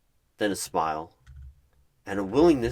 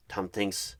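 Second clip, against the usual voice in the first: ordinary delivery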